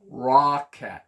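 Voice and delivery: "rocket" as rock-ET, loud and falling; rhyme sounding-out. Shown as a demonstration. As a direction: sound out "rocket" in two full syllables. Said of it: "Does it sound like something else?".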